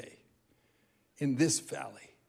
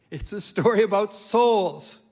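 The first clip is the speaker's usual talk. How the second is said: normal talking voice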